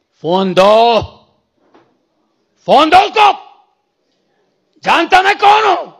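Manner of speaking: loud, high, commanding shout. Retold or imitated. Preacher demanding the phone from the brother, shouting. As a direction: yell down the phone with full authority. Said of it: "मैंने जोर से चिल्लाया ना".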